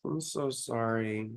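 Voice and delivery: low, slow, hushed condolence; sincere sympathy. Real, not acted. Streamer to viewer who lost a child, real soft sympathy. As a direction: say it softly, with real sorrow.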